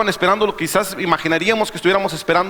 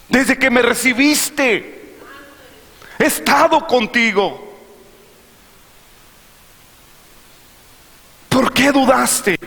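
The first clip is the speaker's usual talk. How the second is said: Jesus reproaching Peter; 5 semitones higher, slower than usual. Acted. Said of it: "Le dice a Pedro".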